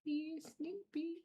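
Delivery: high, slow cooing question; pet talk. Real, not acted. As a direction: coo it slowly to a sleepy cat. Talking to the cat in her arms.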